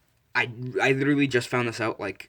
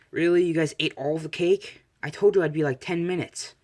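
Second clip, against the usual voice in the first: louder, higher, put-out complaint; indignant protest; 7 semitones higher, 5 dB louder than usual. Acted. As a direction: protest loudly at being left out. Latecomer finds the cake eaten.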